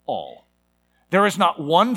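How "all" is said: "all" set apart after a pause, dropped low; emphasis on "all". Shown as a demonstration. Preacher stressing a word of scripture, emphasis on "all". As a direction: let "all." land low and quiet on its own. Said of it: "I'm gonna emphasize the word".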